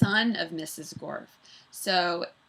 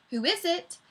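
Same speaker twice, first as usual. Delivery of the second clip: pleasant gentleman; 10 semitones higher than usual. Acted. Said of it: "in the pleasant voice he stole".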